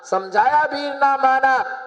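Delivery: high, held refrain for a crowd; emphatic refrain. Real, not acted. Preacher giving congregation the refrain, emphatic. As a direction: lift it up for the listeners to echo.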